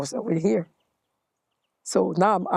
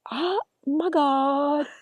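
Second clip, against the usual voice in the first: higher, slow, breathless wonder; awed inner voice; 8 semitones higher than usual. Retold or imitated. Seated among kings, her inner voice in high slow awe. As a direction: marvel at it slowly, high and hushed. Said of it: "and I'm like".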